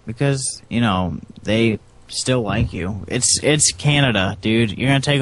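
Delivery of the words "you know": ordinary talk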